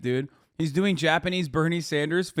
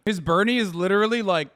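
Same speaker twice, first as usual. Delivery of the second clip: ordinary talk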